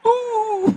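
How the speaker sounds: high, long mock sob; put-on wail of joining the weepers; imitated crying wail. Preacher imitating people crying along with crowd, mock wail. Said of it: "ikut nangis".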